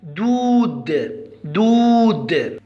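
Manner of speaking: دود very slow, the oo held long; long oo demo. Shown as a demonstration. Teacher showing pupils how the word sounds, دود slowly stretched. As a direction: draw out the vowel in دود. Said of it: "لاحظوا اللفظ هنا كيف نلفظ الكلمة".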